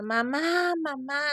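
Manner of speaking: high, loud call for mother; retold child's cry. Boy throws himself on his mother's grave crying out. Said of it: "comenzó a gritar".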